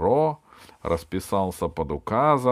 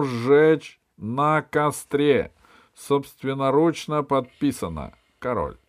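decree reading; 8 semitones higher, 8 dB louder than usual. A narrator's reading. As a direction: read it like a herald. (торжественное оглашение указа)

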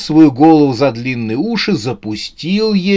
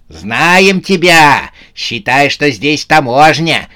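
shouting; 3 semitones higher, 5 dB louder, slower than usual. A narrator's reading. Сюзанна confronts Новый год at the door, shouting. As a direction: shout it like a challenge. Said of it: "крикнула Сюзанна".

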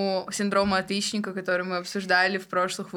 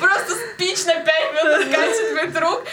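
laughing; 8 semitones higher, 6 dB louder than usual. Real, not acted. Laughing about her long speech.

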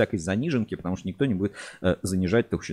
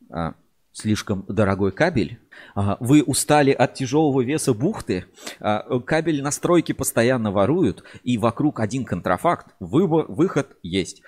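over eager infomercial. Acted. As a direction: sell it like a late-night teleshop host. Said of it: "реклама телемагазина".

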